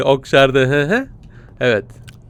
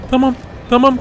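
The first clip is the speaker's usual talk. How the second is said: normal talking voice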